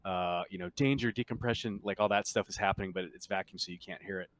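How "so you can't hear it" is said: usual speaking voice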